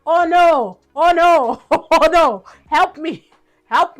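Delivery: hammed-up cry for help; playfully panicked plea to be rescued; panicked man. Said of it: "He was just like".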